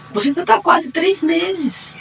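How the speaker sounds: slow, high, drawn-out announcement; doctor's announcement. Retold or imitated. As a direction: savour the news, stretching it out. Doctor reads ultrasound result. (médica anunciando, lenta e aguda)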